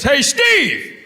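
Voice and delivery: high holler from across the room; yelling out. Acted. Another guy calling to the walker in the theater, loud high yell.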